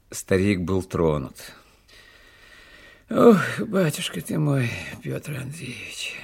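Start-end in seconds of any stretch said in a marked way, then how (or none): 3.1-6.1 put-on old servant's fond lament, slowing and dropping at the name; moved old servant. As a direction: sigh it out as a touched old retainer. Moved old servant answers his master.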